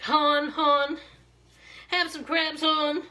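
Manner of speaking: put-on a touch of Baltimore hon accent. Imitating Baltimore folks for viewers, light Baltimore accent. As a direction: mimic a Baltimore local offering crabs. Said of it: "people from Baltimore have more of that".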